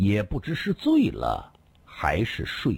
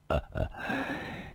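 soft breathy laugh, lightly voiced; a quick amused laugh before answering; 轻声一笑; 9 dB quieter than usual. A narrator's reading. 被问为何装醉后一笑. (被问装醉时轻轻一笑)

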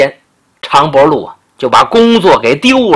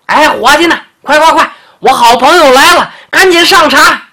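high, louder call across the shop; calling out for the waiter; 10 semitones higher, 3 dB louder than usual. Acted. Changbolu calling the shop boy to serve tea, high loud call.

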